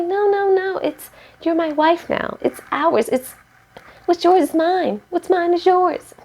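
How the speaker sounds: high sweet-talking imitation of Jose; mock Jose. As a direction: lampoon him reassuring his wife. Voicing Jose insisting on "ours.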